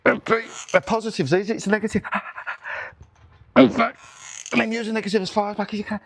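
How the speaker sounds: high, strained voice mid-rep; squealing through the last reps. Real, not acted. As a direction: push the words out under heavy load. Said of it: "squealing like a baby there".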